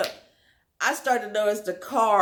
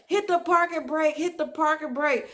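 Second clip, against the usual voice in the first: urgent and higher, repeated like a retold yell; urgent mock shout; 7 semitones higher than usual. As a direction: crie l'ordre en pressant, comme en le racontant. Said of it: "He's like".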